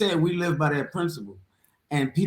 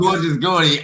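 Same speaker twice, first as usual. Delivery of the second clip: mock shout; 5 dB louder than usual. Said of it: "repeating the nickname".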